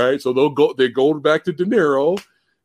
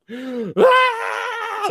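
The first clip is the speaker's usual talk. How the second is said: retold scream. Watching the commercial at home, mock scream. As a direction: yelp of shocked laughter. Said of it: "You know, screaming".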